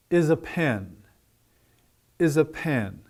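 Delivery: "is a" light and quick, "pen" stressed and falling; weight on "pen", none on "is a". Shown as a demonstration. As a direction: move quickly through "is a" onto a long "pen". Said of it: "stress is on the object word".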